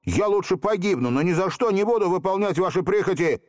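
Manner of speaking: мрачный выкрик, выше обычного; выкрикнуть мрачно; grim outcry. A narrator's reading. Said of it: "мрачно выкрикнул". (мрачно выкрикнуть)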